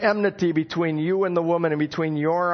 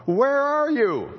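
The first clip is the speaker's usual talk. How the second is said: slow, higher, drawn-out gentle call; God calling out; 8 semitones higher, slower than usual. Acted. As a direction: call slowly as if searching a garden. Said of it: "and God calls out".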